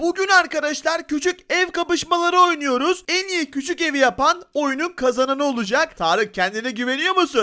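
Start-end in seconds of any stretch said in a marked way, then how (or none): 0.0-6.0 excited intro. Acted.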